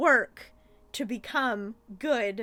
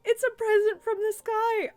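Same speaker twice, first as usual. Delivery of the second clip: higher, louder, wide-eyed kitten; excited kitten voice; 10 semitones higher, 5 dB louder than usual. Retold or imitated. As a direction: squeal it like a thrilled little cat. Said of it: "And Sagwa's like".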